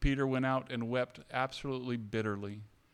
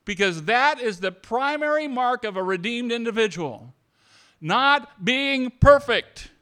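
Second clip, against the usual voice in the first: louder, higher, rousing the room; full-force preaching; 5 semitones higher, 5 dB louder than usual. Acted. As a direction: build it like a pulpit climax.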